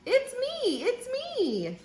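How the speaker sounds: very high singsong little voice; sheep character. A narrator's reading. Sheep revealing who is under the mask, high singsong. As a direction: sing out each "me" up high. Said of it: "says the sheep".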